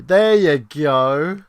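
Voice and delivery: loud, slow, sing-song cheer; loud drawn-out relief. Real, not acted. Streamer cheering a viewer's reply, loud and slow. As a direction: belt it out slowly, pleased.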